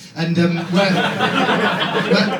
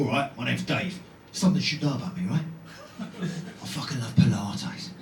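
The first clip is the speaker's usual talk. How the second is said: low, softer London geezer; London accent; 7 semitones lower, 8 dB quieter than usual. Acted. London bloke showing his feminine side, low London accent. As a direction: confide like a London hard man, low and soft. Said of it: "imagine somebody with an accent".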